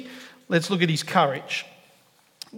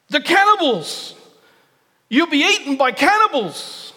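high, loud mock panic over cannibals; alarmed warning; 9 semitones higher, 3 dB louder than usual. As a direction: cry out in horror like a fussy old man. Old man warns of cannibals.

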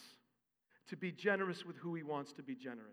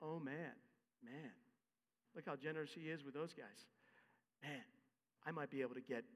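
1 laborer daydreaming; 3 semitones lower, 10 dB quieter than usual. Retold or imitated. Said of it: "you're, like, thinking".

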